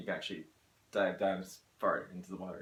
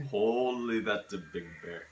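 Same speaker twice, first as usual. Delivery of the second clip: a light, self-mocking Alaskan local accent; a slowed Alaskan local's "Holy, that's a big bear"; 5 dB louder, slower than usual. A mate's bad Alaskan accent, done for laughs. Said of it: "my best and worst accent".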